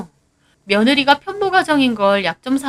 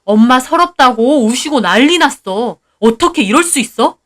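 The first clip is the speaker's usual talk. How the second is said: loud accusing voice; husband confronting; 3 semitones higher, 7 dB louder than usual. Acted. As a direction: demand an answer angrily. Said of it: "남편이 와서 저에게 따지더군요". (따지는 남편)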